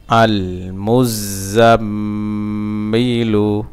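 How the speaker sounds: "আল মুজাম্মিলু" in one slow flow with a long hummed mm; joined reading pronunciation. Shown as a demonstration. Teacher reading the word whole for learners, drawn out and joined. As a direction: connect "আল মুজাম্মিলু", lingering on the m. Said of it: "reading উচ্চারণ".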